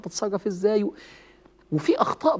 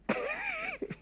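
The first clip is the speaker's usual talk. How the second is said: short high giggle; 14 dB quieter than usual. Real, not acted. Laughs at the blunt version.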